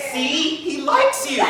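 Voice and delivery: high, sweet reassuring voice; mock sweet owner. Owner cooing to her date about the bird. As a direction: coo it like a doting pet owner.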